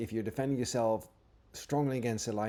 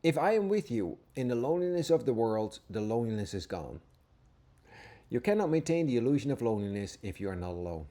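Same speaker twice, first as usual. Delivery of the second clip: scripture reading; 3 dB louder than usual. Acted. Teacher reading Jesus' words to listeners, earnest and reverent. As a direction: read each line as if it is holy.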